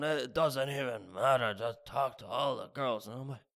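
lightly voiced barfly. Barfly advice, then own high reply.

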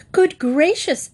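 high startled gasp of words; shocked exclaim. A narrator's reading. Mrs Bird hearing the bear may be overboard, high alarm. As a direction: cry it out in alarm. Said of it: "exclaimed Missus Bird".

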